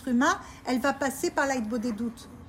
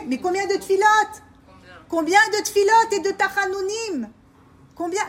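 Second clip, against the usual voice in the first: raised, louder preaching question; emphatic call; 5 semitones higher, 6 dB louder than usual. Real, not acted. Preacher to the women, insistent and loud. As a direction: press them with how many prayers.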